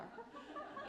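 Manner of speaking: sudden high-pitched laugh; amused squeal of laughter; high burst of laughter. Real, not acted. Laughing at the frog-throwing scene.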